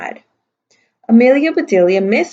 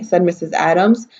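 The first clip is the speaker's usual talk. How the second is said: ordinary delivery, nothing marked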